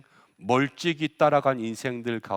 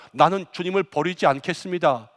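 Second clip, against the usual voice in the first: Peter's confident vow, pushed out loud; a disciple boasting he will never leave; boastful shout; 4 semitones higher, 6 dB louder than usual. Retold or imitated. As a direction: proclaim it like a bold promise. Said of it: "그렇게 큰 소리 쳤습니다".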